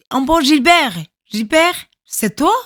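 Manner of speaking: high, loud surprised cry; astonished recognition. Retold or imitated. Woman recognizing her cousin among soldiers, astonished cry. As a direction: gasp out the name in disbelief.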